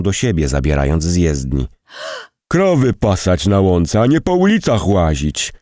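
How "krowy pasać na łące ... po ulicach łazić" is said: higher-pitched scolding, mildly acted; hurled invective. A narrator's reading. Car driver berates the woman in the road, hurling invective. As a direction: scold like an irate driver, voice rising. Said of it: "inwektywy lecące z ust kierowcy".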